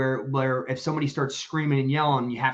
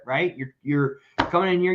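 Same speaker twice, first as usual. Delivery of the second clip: normal talking voice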